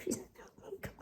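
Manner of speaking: breathy whispered tag question; whispered aside. Real, not acted. Vlogger to viewers about the cat, whispered aside.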